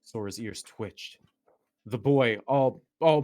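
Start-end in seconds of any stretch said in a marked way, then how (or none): 1.9-3.2 loud. Acted. Reading fanfic in caps, loud.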